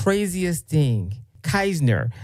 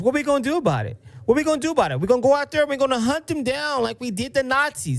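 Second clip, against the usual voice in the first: mock rally cry; 8 semitones higher, much faster than usual. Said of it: "That's what he's saying".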